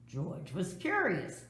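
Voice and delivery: usual speaking voice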